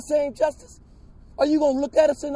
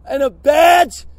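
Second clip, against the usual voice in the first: outraged shout; 6 dB louder, slower than usual. Real, not acted. Outraged question at hearing.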